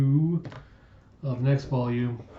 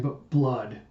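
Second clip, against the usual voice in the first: usual speaking voice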